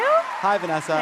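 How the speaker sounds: high, loud happy hello; excited hi. Real, not acted. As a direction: greet the guest with delight. Greeting guest.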